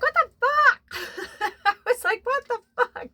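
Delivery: very high, loud, laughing what-the-fuck; shocked laughing exclamation. Retold or imitated. Hearing the next song answer her, squealing in laughing shock.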